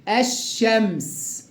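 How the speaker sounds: الشمس slowly with a long double sh; lam merged into sh. Shown as a demonstration. Teacher shows the merging, slow doubled sh. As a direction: hold the ش in الشمس, lam silent. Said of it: "اللام مدغمة في الشين".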